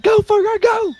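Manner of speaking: very high squeaky donkey; high-pitched animal sidekick cheer. Acted. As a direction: urge a friend on in a cartoon squeal. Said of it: "He's like".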